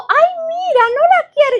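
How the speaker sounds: very high, loud mocking whine; mocking friend. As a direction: squeal it like the shocked neighbor. Imita a la amiga sorprendida. (la amiga burlada, muy aguda y fuerte)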